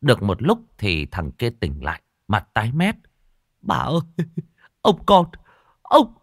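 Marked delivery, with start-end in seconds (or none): 3.6-6.1 terrified servant tripping over words; stammering panic. Acted. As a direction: stutter that climbs into a high wail. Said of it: "Sao mày cứ lắp ba lắp bắp thế".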